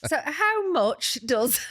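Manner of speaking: slow, laughing through the words; barely holding in a laugh. Real, not acted.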